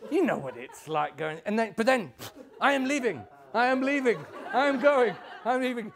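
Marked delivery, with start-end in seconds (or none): 2.6-5.9 high, loud, insistent announcements; hammed-up threats to leave, each punched out; dramatic exit. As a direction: make a big show of storming off. Old woman threatens to leave again.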